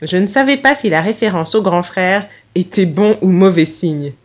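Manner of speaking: usual speaking voice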